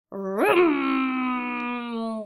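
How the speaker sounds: drawn-out revving vroom; engine vroom. Acted. Dump truck speeding round a curve, revving vroom. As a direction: rev like an engine.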